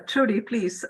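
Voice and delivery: ordinary talk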